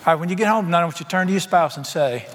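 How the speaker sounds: ordinary delivery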